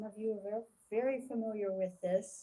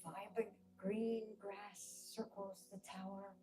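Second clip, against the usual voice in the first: guided meditation. Acted. Leader talking listeners through a calming visualisation, guided meditation. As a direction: guide listeners into calm, phrase by measured phrase.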